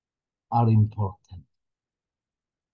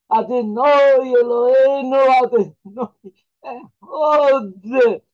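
voice breaking; 10 semitones higher, 6 dB louder than usual. Real, not acted. Rabbi moved to tears reciting the Shema, voice breaking. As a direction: let the prayer break into crying. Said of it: "I don't want to cry".

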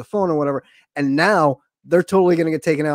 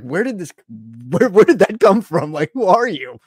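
mock astonishment; 3 semitones higher, 6 dB louder than usual. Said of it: "and you're like".

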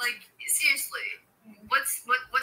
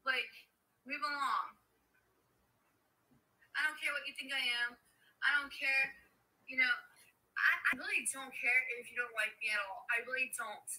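nearly whispering, brushing it off; quiet; 9 dB quieter than usual. Real, not acted. Dismissive, quiet monologue.

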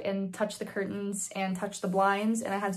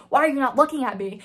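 loud, higher-pitched demand; imitated yelling; 4 semitones higher, 7 dB louder, faster than usual. Doctor drilling his stressed patient, mock yelling. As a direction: press hard with the question. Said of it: "Because your voice is raised".

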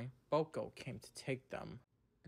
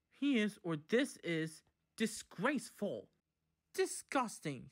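higher-pitched outraged verdict; indignant; 6 semitones higher, 3 dB louder than usual. A narrator's reading. Engines complain about Boko in the shed, indignantly. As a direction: declare it with indignation. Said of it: "had an indignation meeting".

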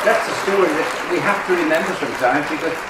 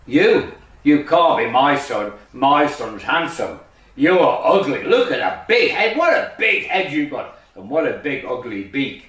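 rejecting father duck. Acted. Daddy duck disowns his ugly son, scornful. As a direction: lean hard on each insult to send him off.

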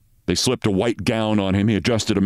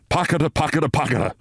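higher, rhythmic machine chant; machine noise; 6 semitones higher, faster than usual. A narrator's reading. Narrator mimicking the anesthetizer going pocketa. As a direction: tick it out like a failing machine. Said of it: "began at this moment to go".

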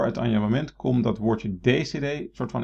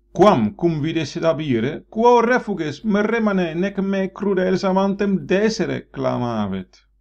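high, loud, slow declaiming of the verse; theatrical Ovid reading, raised pitch and volume; dramatic Latin recitation; 9 semitones higher, 7 dB louder, slower than usual. Shown as a demonstration. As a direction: declaim it like a lament on stage.

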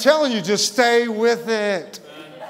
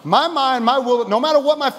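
high, emphatic pulpit delivery; impassioned preacher, pitch lifted; fervent preaching; 6 semitones higher than usual. Real, not acted. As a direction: proclaim the psalm with rising fervor. Preaches the psalm with force.

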